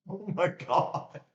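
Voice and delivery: high, loud, slow disbelief; shocked exclamation. Real, not acted. Streamer reacting live to the stream, shocked exclamation. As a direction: blurt it out high and drawn out.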